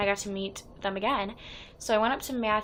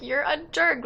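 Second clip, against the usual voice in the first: mock indignation; 6 semitones higher, 5 dB louder than usual. Mock scolding after spoiled picture.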